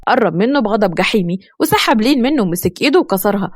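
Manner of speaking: ordinary delivery, nothing marked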